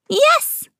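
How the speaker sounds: very high squeaky troll shout. A narrator's reading. Trolls shouting back, high and squeaky. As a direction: yell yes like an eager troll. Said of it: "they shouted".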